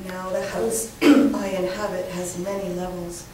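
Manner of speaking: measured reading with firm emphasis; poetry reading. Acted. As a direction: project each phrase of the poem. Continues reading the poem.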